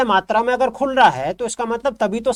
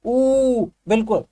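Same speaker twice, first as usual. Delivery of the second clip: slow demonstration; 3 semitones higher, slower than usual. Shown as a demonstration. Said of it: "आप देखिए".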